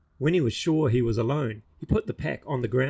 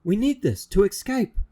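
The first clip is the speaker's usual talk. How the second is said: put-on insistent boy; 9 semitones higher, 5 dB louder than usual. Roo insisting.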